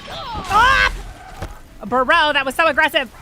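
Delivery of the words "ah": sharp high shriek of alarm; a real yelp, loud and high; startled scream. Real, not acted. Streamer ambushed in game, shrieking high. Enemy attacks her in game.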